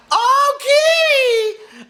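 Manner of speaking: high, loud drawn-out cry; calling to the kitty with a long, high, startled laugh; surprised call. Real, not acted. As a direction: call out to the cat in startled delight. Cat interrupts, calls out.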